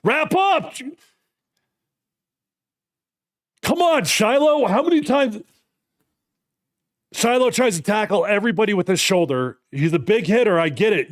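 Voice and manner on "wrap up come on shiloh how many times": high exasperated yell at a player. Real, not acted. Fan yelling at Shiloh through the TV, exasperated high yell. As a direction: shout the correction, fed up.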